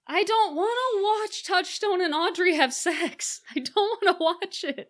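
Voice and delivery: whiny protest. Real, not acted. Whining about an unwanted scene.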